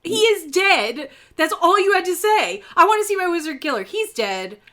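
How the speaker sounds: loud, high mock-exasperated outburst. Host showing what the character should have said, exasperated. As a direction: hammer it out as the blunt answer. Exasperated rant at withheld news.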